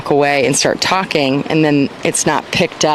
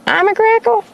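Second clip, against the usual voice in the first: silly high voice. Pretend. Silly voice after line 10.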